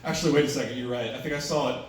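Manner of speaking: ordinary delivery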